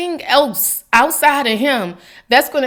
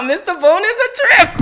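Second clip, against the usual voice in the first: laughing; 7 semitones higher than usual. Real, not acted. Laughing at neighbor's call.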